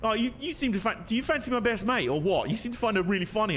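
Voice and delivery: high-pitched mock girlfriend voice; imitated girlfriend. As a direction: quote a suspicious girlfriend, pitched well up. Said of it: "one night she was like".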